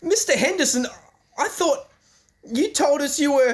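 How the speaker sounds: high-pitched stunned greeting; shocked surprise. Acted. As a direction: gasp it out in disbelief at the uncle's return. Shocked at uncle's return.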